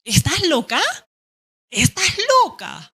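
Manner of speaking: rising high and stretched, astonished; incredulous. Shown as a demonstration. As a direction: ask it like you can't believe your ears. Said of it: "es la actitud nada más".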